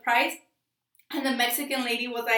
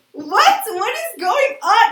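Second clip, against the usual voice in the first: shocked; 8 semitones higher, 7 dB louder, slower than usual. Retold or imitated. Shocked reaction to Spanish reveal.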